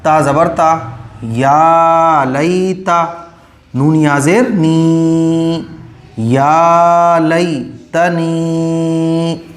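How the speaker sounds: each syllable stretched very long; slow drawn-out recitation. Shown as a demonstration. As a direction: spell out या लई तनी sound by sound, slowly. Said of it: "clear है?". (या लई तनी को बहुत खींचकर, धीरे-धीरे पढ़ना)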